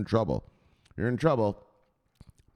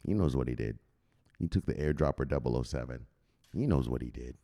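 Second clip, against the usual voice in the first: lower, quieter knowing menace; ominous low tone; 10 semitones lower, 4 dB quieter than usual. Real, not acted. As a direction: say it darkly like a grudge. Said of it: "Why do you keep calling him".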